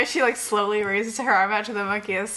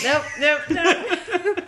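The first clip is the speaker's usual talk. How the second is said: laughing; 8 semitones higher, 4 dB louder, much slower than usual. Real, not acted. Refusing, laughing.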